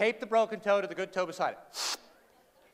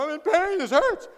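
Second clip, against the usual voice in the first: mock whiny complaint; 9 semitones higher, 6 dB louder than usual. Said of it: "you know".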